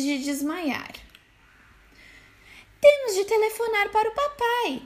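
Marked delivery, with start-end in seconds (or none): 2.8-4.8 put-on much higher, panicked little kids' shout; high kid goats yelling for dad. A narrator's reading. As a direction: squeal it like frightened little goats. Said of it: "gritaram os cabritinhos".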